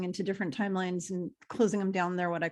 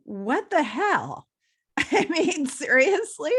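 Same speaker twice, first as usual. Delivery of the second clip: disbelieving; 7 semitones higher, 5 dB louder, slower than usual. Retold or imitated. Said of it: "And I'm like".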